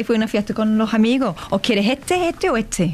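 Spanish with dropped S sounds; Andalusian accent. Shown as a demonstration. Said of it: "the best example is to say".

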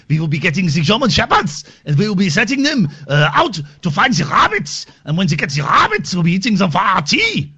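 high, loud mock German; German accent. Comic German answering about Germans, hammed-up German accent.

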